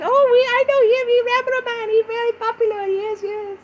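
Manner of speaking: very high, quick falsetto listener; squeaky put-on fan voice, rushed at first; Malaysian fan. Imagined listener in Malaysia praising the host, Malaysian fan voice. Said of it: "They might be in Malaysia and be like".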